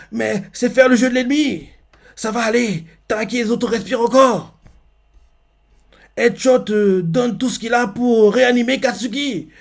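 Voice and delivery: voix haute, ferme et encourageante; Mirio qui encourage. Retold or imitated. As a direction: rassurer un ami en perdition avec force. Mirio encourage Deku en criant. (Mirio remonte le moral de Deku, voix haute)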